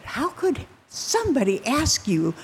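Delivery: high, swinging incredulous protest. Retold or imitated. Rich man balking at giving up his money, incredulous. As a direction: voice disbelief, pitch leaping up at the end. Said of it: "He couldn't believe it".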